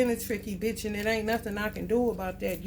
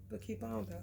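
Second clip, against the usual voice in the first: fading to quiet; 9 dB quieter than usual. Shown as a demonstration.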